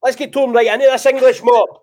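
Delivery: rally the troops into the match; roaring Scottish battle cry. Shown as a demonstration. Scottish host demonstrating the sign-off, roaring rallying call. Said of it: "Try again".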